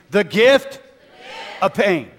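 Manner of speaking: high, loud call to the crowd. Shown as a demonstration. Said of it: "Come on, I can't hear you".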